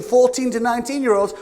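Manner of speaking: ordinary delivery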